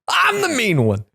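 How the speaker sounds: high-pitched playful protest; hammed-up high outburst; mock indignation. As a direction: protest it high, falling at the end. Mock indignation at being called mean.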